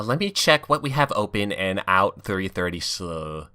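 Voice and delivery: normal talking voice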